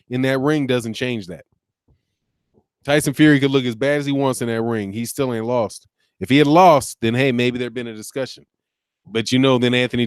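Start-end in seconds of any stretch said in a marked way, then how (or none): none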